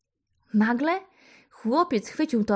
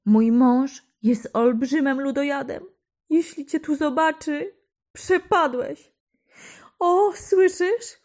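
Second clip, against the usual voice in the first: put-on giant's wife, higher and afraid, hushing the boy; frightened warning; 6 semitones higher, 4 dB louder than usual. A narrator's reading. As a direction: warn him, scared the giant will hear.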